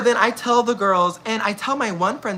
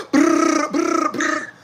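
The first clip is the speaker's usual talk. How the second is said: phone buzz; 10 semitones higher, 3 dB louder, much slower than usual. Retold or imitated. Imitating phone buzzing on bus.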